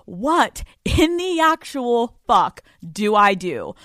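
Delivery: drawn-out, exasperated plea. Acted. Reading a listener's letter for advice, exasperated. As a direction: stretch each word slowly in disbelief.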